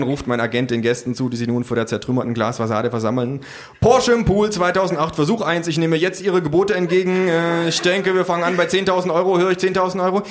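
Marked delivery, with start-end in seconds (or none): 3.8-10.2 higher auctioneer patter. Acted. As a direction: run the bidding like an auction. Agent plays auctioneer. (höhere Auktionatorstimme)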